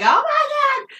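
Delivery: high, loud mock scream of shock; imitated scream. Imagining hitting a deer, loud mock scream. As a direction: shriek it like you just hit a deer. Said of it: "I would be, like, screaming".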